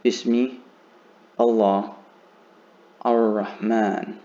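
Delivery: "Bismi", "Allah", "Ar-Rahman" each said apart with a clear pause between; halting word-by-word "Bismi. Allah. Ar-Rahman."; words unjoined. Shown as a demonstration. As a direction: say "Bismi ... Allah ... Ar-Rahman" as three separate words, nothing running together. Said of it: "So I'm not gonna say".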